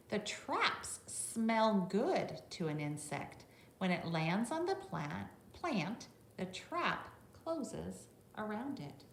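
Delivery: bright read-aloud voice for a class; reading to children. Acted. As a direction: read it out clearly for the little ones. Reads the book text to children.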